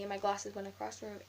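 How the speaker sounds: ordinary delivery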